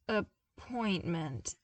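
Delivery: drawn-out, sinking recognition; sleepy slow dawning appointment; slow realization. Acted. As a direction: let the penny drop slowly.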